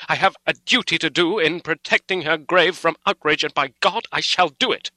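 high, loud, defiant vow; storm of passion. A narrator's reading. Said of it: "in a storm of passion".

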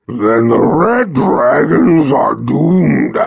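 slow, loud mournful dragon cry; despairing dragon. Acted. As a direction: wail it like a doomed beast. Dragon voice held.

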